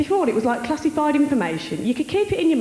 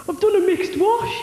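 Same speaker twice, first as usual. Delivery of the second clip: lightly imitated boyfriend, pitched higher; hint of hapless boyfriend; 7 semitones higher, 4 dB louder than usual. Comic voicing her boyfriend phoning her at work, lightly mimicked. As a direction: act out the boyfriend's proud little confession. Boyfriend's phone call acted out.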